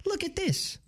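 rough cartoon rabbit impression; Bugs Bunny. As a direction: pitch it up like a cartoon wise guy. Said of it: "I can't do Bugs Bunny".

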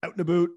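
"Out and a boot" pitched high and loud, oo in "boot". Shown as a demonstration. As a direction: exaggerate the Canadian oo in "Out and a boot". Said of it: "things that Canadians are known for".

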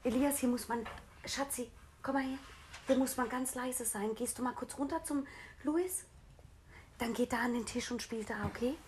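hushed. Real, not acted. Hushed aside to child during recording.

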